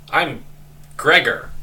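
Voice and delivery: lightly voiced cheerful roach; a hint of a chipper bug introducing itself; cheery roach voice. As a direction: roach guard greets the adventurers, lightly cheery. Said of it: "it says in a cheery, happy voice".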